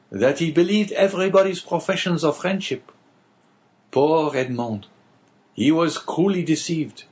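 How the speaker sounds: rueful man recalling a betrayed friend; Caderousse's voice. Acted. Innkeeper telling the abbé of Dantès, lightly voiced and rueful.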